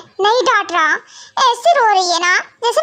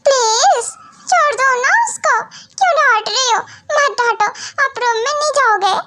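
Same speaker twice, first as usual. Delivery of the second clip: put-on high, pouty little-girl voice begging daddy; childlike pleading; 5 semitones higher than usual. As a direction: whine like a small child who doesn't want mommy scolded. (ऊँची, बच्चों जैसी रूठी आवाज़ में मिन्नत)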